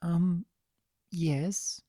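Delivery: a hint of a nervous, soft voice; hesitant and shy. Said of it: "unable to hide her nervousness".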